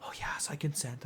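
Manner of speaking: whispered mock consent; whispered yes. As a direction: breathe it out in a whisper.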